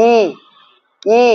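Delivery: long ই with lips spread wide; drawn-out vowel demo. Shown as a demonstration. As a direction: stretch ই out, mouth widened. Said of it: "মুখটা আমাদের একটু প্রসারিত হচ্ছে". (মুখ ছড়িয়ে টানা ই)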